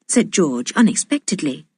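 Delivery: ordinary delivery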